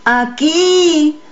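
high, held answering call; calling back. A narrator's reading. Boy answers the voice in the fog, calling back. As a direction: call back across the distance, vowel stretched. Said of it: "contesté".

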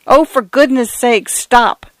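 high, panicked plea; desperate plea. A narrator's reading. Mister Fotheringay pleading with the flood, desperate. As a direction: beg with mounting panic. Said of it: "cried Mister Fotheringay".

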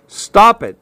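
high-pitched, curt order. A narrator's reading. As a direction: order them to stop at once. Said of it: "he ordered".

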